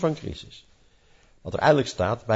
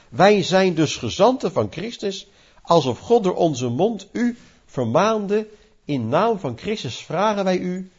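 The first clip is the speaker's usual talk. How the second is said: raised solemn reading of the verse; scripture reading; 4 semitones higher than usual. Acted. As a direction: read the text out with weight. Reads scripture aloud. (plechtig voorgelezen bijbeltekst)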